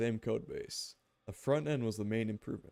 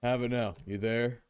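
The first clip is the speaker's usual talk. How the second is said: normal talking voice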